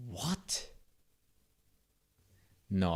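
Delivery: ordinary talk, nothing marked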